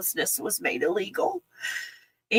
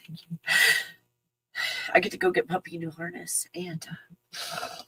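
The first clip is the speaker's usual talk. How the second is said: whisper; 6 dB quieter than usual. Real, not acted. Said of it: "whispered gratitude".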